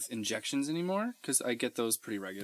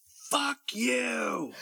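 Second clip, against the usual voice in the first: much higher angry yell; shouted curse; 12 semitones higher than usual. Retold or imitated. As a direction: snap it at the other driver. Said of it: "my grandfather said".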